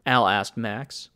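plain reading voice

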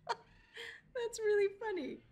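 laughing slowly through the words, pitch up; real laughter. Real, not acted. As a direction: giggle at your own pun.

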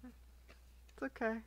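slow whisper, consoling yourself; whispered self-comfort. Real, not acted. Pack opener whispering comfort to herself after a bad pull. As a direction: breathe it out under your breath. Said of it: "I die a little more on the inside".

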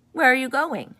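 loud high shouted wolf question. A narrator's reading. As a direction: bark it out like a wolf. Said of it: "shouted the wolf".

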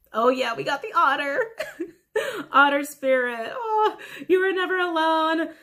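high, loud, moved exclamation; high and loud with emotion; emotional delight. Real, not acted. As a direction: cry out, moved by the card. Moved on drawing the otter card.

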